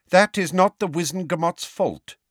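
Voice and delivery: cold, high-pitched reproof. A narrator's reading. Cold male voice rebuking Harry for lateness, cold. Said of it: "A cold male voice".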